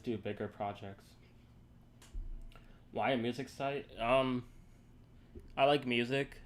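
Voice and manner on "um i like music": talking through hiccups; words broken by hiccups; hiccuping. Real, not acted. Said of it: "Excuse me, I'm hiccuping".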